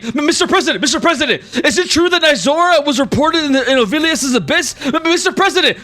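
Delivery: high, overlapping reporter shouts; clamouring reporters. Acted. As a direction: push to be heard at a press conference. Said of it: "are all yelling and saying".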